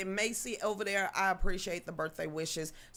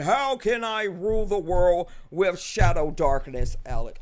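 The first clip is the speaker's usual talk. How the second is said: mock world-domination voice; 9 dB louder than usual. Imagining asking Alex to rule the world, mock villain boom. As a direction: declare it loudly like a hammy supervillain. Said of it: "I'm not going in there saying".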